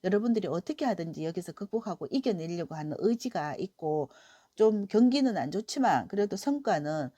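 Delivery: usual speaking voice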